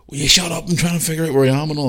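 high, fast irritated snap; fed up, snapping to be let think; exasperated dad. Retold or imitated. Dad snapping at mum while lost in the street, exasperated. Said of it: "ready for fucking killing her".

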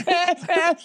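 put-on high, squeamish "eh" of a grossed-out kid; disgusted kid noise. Kid running home with doo-doo on his hand, squeamish high "eh". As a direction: squeal "eh" like a kid holding a dirty hand away. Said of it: "I'm making that fucking noise like".

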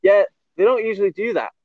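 high-pitched imitation of the guide; retold local guide's voice; local boatman. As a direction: mimic the boatman, pitched well up. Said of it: "I can't do the accent again".

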